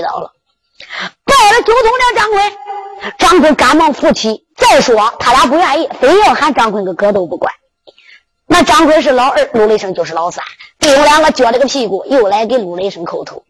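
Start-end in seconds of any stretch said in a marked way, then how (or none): none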